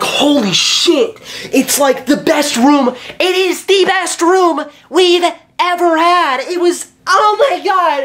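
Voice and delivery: overjoyed high-pitched exclaiming; ecstatic shout. Retold or imitated. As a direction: burst out like you just found paradise. Bursts out of best room.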